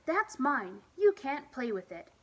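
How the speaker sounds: put-on loud angry child's shout. Child shouting at friend David over a game, loud. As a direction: shout it like a kid guarding his toy. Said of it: "I shouted".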